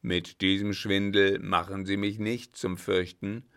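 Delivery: loud, scornful dismissal; contemptuous captain. Acted. Captain scorns the German's magic, loud and contemptuous. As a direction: slap the nonsense down.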